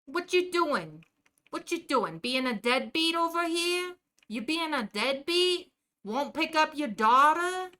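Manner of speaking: put-on heckling a deadbeat dad in a New Jersey accent; lightly done Jersey wise guy. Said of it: "It leans into stereotypes".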